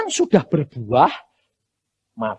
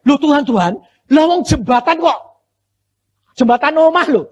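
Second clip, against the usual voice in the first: amazed exclamation; 9 semitones higher, 7 dB louder than usual. Retold or imitated. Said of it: "aku sampai gini".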